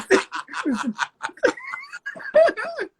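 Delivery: loud, high burst of laughter; delighted shrieking laugh. Real, not acted. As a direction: crack up at the joke. Laughs at the phone-number joke.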